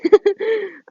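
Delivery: short high giggle. Real, not acted. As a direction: laugh it off.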